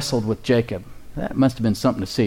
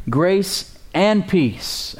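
very slow, drawn-out scripture reading, pitched up; 5 semitones higher, much slower than usual. Real, not acted. Preacher reading scripture to the congregation, very slowly. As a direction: stretch each word out, slowly and deliberately. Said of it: "I'm gonna read it slowly".

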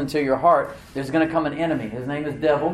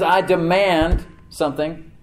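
loud, higher, drawn-out demand; Paul; 5 semitones higher, 6 dB louder, slower than usual. Retold or imitated. Preacher voices Paul's demand to the church, authoritative. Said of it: "He spoke with authority".

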